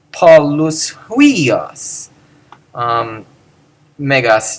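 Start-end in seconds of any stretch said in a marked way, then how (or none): 0.1-2.1 very slow Polus huios; slow model phrase. Shown as a demonstration. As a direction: draw out Polus huios for learners. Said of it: "simply springboards".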